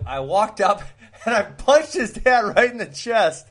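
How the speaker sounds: amused confession. Real, not acted. Storyteller admits punching a friend's dad, laughing confession. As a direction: crack up as you confess it.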